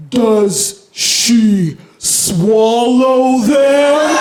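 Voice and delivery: put-on very slow, drawn-out child's question; slow motion. Daughter's horrifying question, dragged out in slow motion. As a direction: stretch every word like slow motion. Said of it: "I heard it in slow motion".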